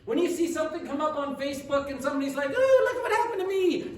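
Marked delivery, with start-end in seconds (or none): 2.5-4.0 high, loud mock-excited squeal; excited bragging poster. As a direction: gush like someone showing off good news. Imitates excited Facebook poster.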